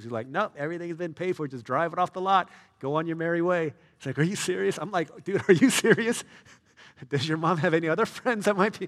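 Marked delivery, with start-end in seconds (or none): 0.3-3.7 cheery dealer. Retold or imitated. Dealer voiced in retold story. 4.2-4.8 amazed friend. Retold or imitated. Friend's disbelief retold. 5.2-8.9 higher and much louder, laughing disbelief; stunned excitement. Retold or imitated. Speaker's own shocked reaction.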